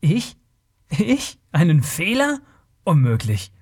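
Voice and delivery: offended, incredulous master thief; indignant anger. A narrator's reading. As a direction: bristle at being accused of a blunder. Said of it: "Taurus Augen flackerten wütend auf".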